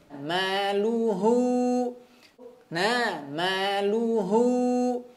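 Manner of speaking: very slow maluhu, h from the base of the throat; slow recitation demo. Shown as a demonstration. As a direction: draw maluhu out slowly. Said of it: "H pangkal tenggorokan lagi". (maluhu sangat pelan, H dari pangkal tenggorokan)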